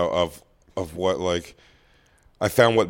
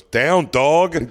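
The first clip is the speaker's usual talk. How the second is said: higher, louder sudden order; real dog command; 7 semitones higher, 6 dB louder than usual. Real, not acted. Podcaster to dog begging mid-story, sharp raised command. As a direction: call the dog off firmly.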